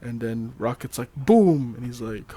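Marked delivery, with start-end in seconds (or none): 1.1-1.7 louder, drawn-up "boom"; mock explosion. Host acts out Rocket setting it off, mock exclamation. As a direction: punch out a playful boom.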